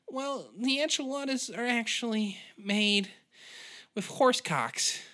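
timid waitress slowing down for the reveal. Acted. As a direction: confide it, slowing on the punchline. Sally reveals menu secret.

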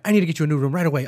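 fast apologetic rush; flustered rush. Retold or imitated. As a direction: hurry it like a panicked clerk. Imitating flustered clerk.

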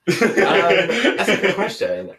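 flustered laugh through the words. Real, not acted. To the host, caught off guard, laughing. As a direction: giggle while stalling for time.

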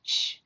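a soft hushing shh. A narrator's reading. Boy hushing the girl in the dark tent with a soft shh. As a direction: shush her quietly so nobody hears. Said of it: "he said".

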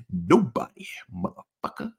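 whispered taunt, toned down to nothing; hushed trash talk after a brag; menacing whisper. Acted. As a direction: hiss it under your breath like a threat. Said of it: "I did a little bit, a little bit more".

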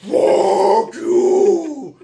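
high, very loud demonic howl; possessed demon. Retold or imitated. Woman being walked out of the restaurant, demon-possessed voice. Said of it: "she was possessed by a demon".

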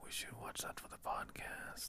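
hushed whispered suggestion. Pretend. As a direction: whisper it like a secret aside. Repeats the whispered aside.

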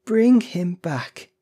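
high, loud, drawn-out plea; desperate cry. A narrator's reading. Old woman begging for her dead son, crying out. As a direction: cry it slowly and insistently. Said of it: "cried the old woman".

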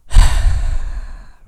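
a loud, long, breathy sigh; deep demo sigh. Shown as a demonstration. Pastor demonstrating Jesus sighing deeply to the congregation, big loud sigh. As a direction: heave a big sigh to show how Jesus sighed. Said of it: "Like that, kinda like that".

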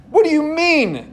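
high, exclaiming question; exclaimed. Acted. Preacher reading Nicodemus, exclaimed and high. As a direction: make it a real exclamation. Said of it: "exclaimed Nicodemus".

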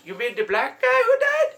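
very high, slow, loud question; mock her in a squeaky slow voice; mimicked clueless girlfriend. Voicing clueless girlfriend's reply.

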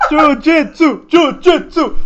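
high, laughing jiu-jitsu said as chu-chu-tsu; laughing repeat. Real, not acted. Joking about why he backs Mackenzie, laughing.

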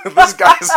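high loud burst of laughter. Real, not acted. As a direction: crack up loudly. Laughing hard at cousin.

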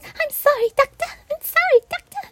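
very high tiny timid voice; Audrey's little voice. Retold or imitated. Fan imitating Audrey's tiny, very high voice. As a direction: squeak it up high, small and apologetic. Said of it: "with her little voice".

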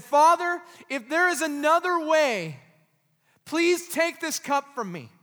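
loud, high pleading prayer; crying out to God. Acted. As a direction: cry it out to the Father. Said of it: "he cried out to God".

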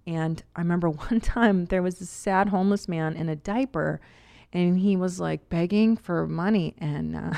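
normal talking voice

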